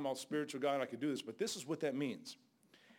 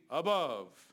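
ordinary delivery

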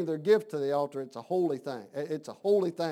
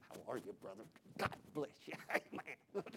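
mock evangelist, very soft and breathy; 5 semitones lower, 16 dB quieter than usual. Visiting evangelist greets a church brother, hushed mock-preacher voice. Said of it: "You get the evangelist thing".

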